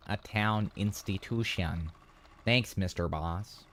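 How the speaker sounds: measured detective-tale narration; story narration. Acted. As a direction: narrate it like a murder mystery, drawing out the endings. Reads game story aloud.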